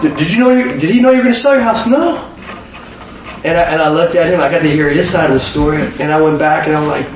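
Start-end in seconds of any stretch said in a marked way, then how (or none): 0.0-2.3 astonished question. Retold or imitated. Buddy and dentist exchange.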